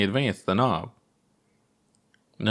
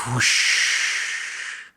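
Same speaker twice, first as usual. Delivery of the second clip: rocket whoosh; 2 semitones higher than usual. Acted. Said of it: "They made rocket sounds with their mouth".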